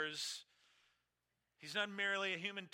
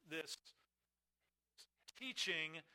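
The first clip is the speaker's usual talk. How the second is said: usual speaking voice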